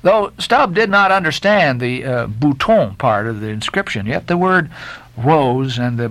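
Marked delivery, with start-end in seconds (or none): none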